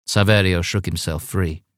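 ordinary delivery, nothing marked

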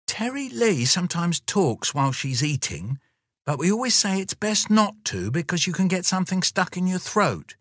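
high, prim mother explaining; Mum's voice. Acted. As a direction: make excuses politely for your child. Mum voice.

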